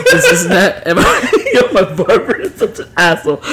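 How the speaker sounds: can't stop laughing telling it. Real, not acted. Laughing while telling the story.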